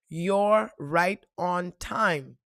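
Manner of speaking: each word of the phrase stretched out slowly; slow, drawn out. Retold or imitated. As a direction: recite the message slowly and deliberately. Recalls the voice in her head.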